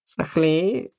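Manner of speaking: slow, disdainful cat voice; snooty cat. A narrator's reading. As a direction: sniff it out like a haughty cat. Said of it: "недовольно фыркнула кошка".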